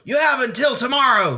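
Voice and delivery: put-on a cross coach ordering the boy; angry cry. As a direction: lay down the deadline, upset. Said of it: "he cried".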